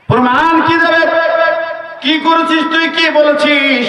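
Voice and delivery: high, sharp demanding mother; mother's alarmed questions. Acted. Mother demanding what her son did, alarmed. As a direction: press the son hard.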